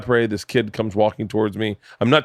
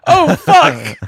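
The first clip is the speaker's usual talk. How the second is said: loud, very high mock cry of dismay; stunned realization; 8 dB louder than usual. Man realizing the kid has two dads, acting out loud dismay. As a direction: blurt it high and loud, hammed-up horror.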